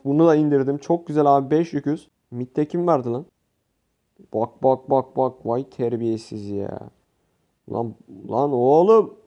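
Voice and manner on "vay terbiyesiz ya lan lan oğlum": ordinary delivery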